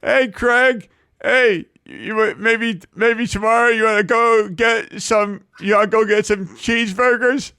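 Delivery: high voice with a little lisp; Nathan impression. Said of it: "spot on".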